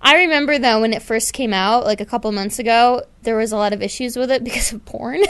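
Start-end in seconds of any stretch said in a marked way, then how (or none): none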